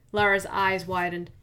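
usual speaking voice

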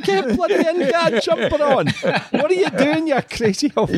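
high, exasperated Scottish voice; Scottish scolding. Retold or imitated. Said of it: "That was probably quite a good Scottish accent".

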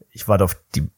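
slow, flat and unimpressed; dry deadpan reply. Retold or imitated. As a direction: brush him off drily. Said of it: "und ich so".

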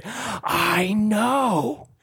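high, drawn-out conspiratorial reply; laughing reply. Retold or imitated. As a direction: stretch it out high, as if just laughing. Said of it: "I start laughing and I say".

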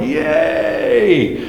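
high, drawn-out mock crowd cheer. Preacher imitates a crowd egged on by the verses, mock cheering. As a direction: whoop "yay" like a whipped-up congregation. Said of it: "we're gonna start cheering".

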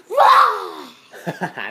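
put-on loud kid battle cry; shouted cry. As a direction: yell it like starting a fight. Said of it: "They go".

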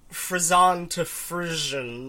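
"Frison" as free-ZON, "Frisian" as FRIZH-un; drawn-out "Frison", then "Frisian" with a zh; contrasted pronunciations. Shown as a demonstration. Accusing co-host of changing it, contrasted pronunciations. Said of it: "You're changing it from".

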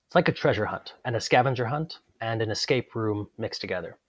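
normal talking voice

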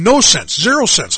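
NO and ZERO punched high and loud; emphatic exasperation. Real, not acted. Telling listeners the Bible baffled him, emphatic. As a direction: hit "no" and "zero" hard. Emphasising his total confusion reading.

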